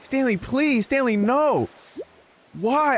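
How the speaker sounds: high, pleading disbelief; alarmed pleading. Retold or imitated. Pleading with classmate Stanley, shocked and high. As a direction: beg him to stop, voice jumping up. Retold panicked reaction to classmate.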